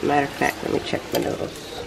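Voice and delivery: usual speaking voice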